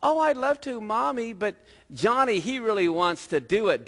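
put-on high-pitched little girl; storyteller's scheming little sister; Sally. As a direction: play sugary-sweet, starting high and easing down. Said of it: "And Sally goes".

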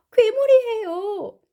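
high, loud, slow cry; storybook monster alarm; alarmed story mother. Acted. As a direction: exclaim as frightened mum. Mrs. Blair's alarm in translation.

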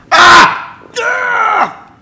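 high, loud yelps of pain; real pain scream. Real, not acted. Player giving himself an electric shock, screaming in pain. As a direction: scream as the current hits.

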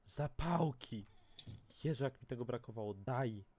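thrilled at the find; delighted exclamation. Real, not acted. Excited find of matches. (radosny okrzyk)

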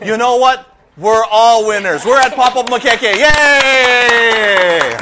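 loud, bright celebration; rally everyone up; excited cheer. Real, not acted. Declaring everyone winners.

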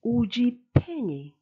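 Uji Penny with Uji high then falling; Chiluba phrase. Shown as a demonstration. Teacher models a Chiluba question to learners. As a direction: call out Uji Penny clearly. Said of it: "where are you".

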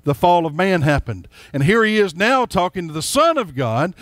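usual speaking voice

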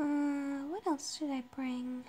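usual speaking voice

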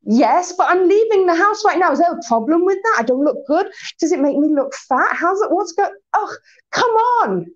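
touchy and wounded, voice climbing to a mock sob; defensive. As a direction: snap back defensively, then crumble with a whimper. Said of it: "if I'm in a position of weakness, I'm defensive".

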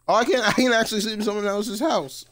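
loud, high-pitched burst of surprise; excited surprise. Real, not acted. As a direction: exclaim in amused disbelief, pitched well up. Surprised he can sleep there.

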